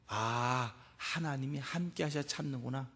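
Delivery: low, hushed sigh of realization; softly modelled "ah, so that's it". Shown as a demonstration. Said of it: "우리 한번 해보실까요?".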